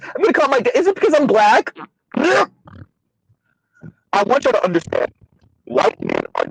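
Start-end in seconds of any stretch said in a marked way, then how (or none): 0.0-1.8 higher mock Valley Girl whine. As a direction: imitate the fake Valley Girl. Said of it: "Finally, she says".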